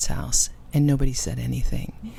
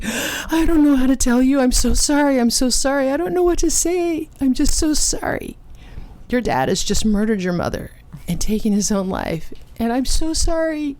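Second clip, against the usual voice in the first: sobbing apology; 9 semitones higher than usual. Retold or imitated. Grieving woman sobbing sorry to the child. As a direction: sob the apology over and over. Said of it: "this woman was crying so hard".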